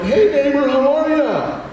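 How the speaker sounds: mock American small talk on picture day; hammed-up cheery American. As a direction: overdo the friendly neighbor.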